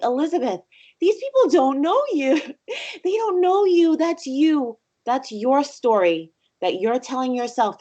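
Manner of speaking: rally yourself, insistent and emotional; emphatic self-pep talk. Retold or imitated. Woman urging her shy self that strangers don't know her, fervent. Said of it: "I was just like".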